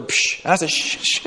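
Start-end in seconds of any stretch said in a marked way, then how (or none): 0.0-0.4 imitated scoffing "psh"; retold psh noise. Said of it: "this was the noise that I heard". 0.7-1.3 imitated urgent "shh"; mock hushing. Hushing wife during church in story.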